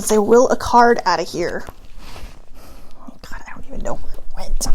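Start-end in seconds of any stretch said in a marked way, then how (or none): 3.3-4.7 fast whispered aside to herself; muttered whisper. Real, not acted. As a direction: hush it quickly while searching. Card flew off, searching.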